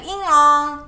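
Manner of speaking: made-up gibberish words; fake foreign language. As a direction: childhood nonsense language. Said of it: "It was like".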